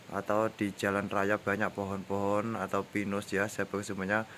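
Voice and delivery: ordinary talk, nothing marked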